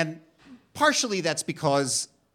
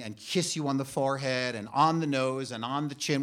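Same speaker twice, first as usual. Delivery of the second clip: ordinary delivery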